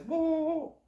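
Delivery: high, loud run of whoas; alarmed protest. Acted. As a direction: stop the hunter in sudden alarm. Duck begs not to be shot.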